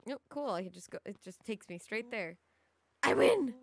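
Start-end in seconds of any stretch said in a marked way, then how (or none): 3.0-3.5 loud, raised victory cry; triumphant shout. Acted. Rollo crowing over winning the race, triumphant shout. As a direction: call out the win at full voice.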